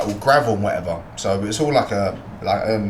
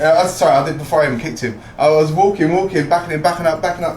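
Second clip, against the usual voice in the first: ordinary talk, nothing marked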